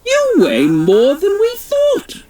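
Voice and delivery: high, strained royal voice; the Queen. Acted. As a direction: complain while struggling to carry the weight. Queen straining while flying.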